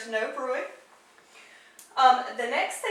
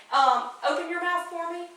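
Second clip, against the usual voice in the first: usual speaking voice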